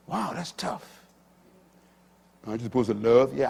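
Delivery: usual speaking voice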